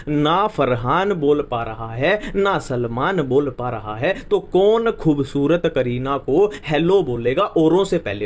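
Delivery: slow and deliberate, every word laid out; measured teacher's recitation; slow recited mnemonic. Shown as a demonstration. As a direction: chant the trick slowly so students can repeat. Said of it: "तीन बार उसको repeat करना".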